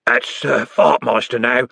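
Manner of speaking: slow, odd mate's voice; a dim lad bragging; dopey boast. Acted. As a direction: puff up the silly title. Carter's comic boast.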